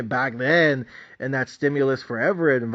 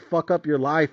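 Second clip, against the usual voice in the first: ordinary delivery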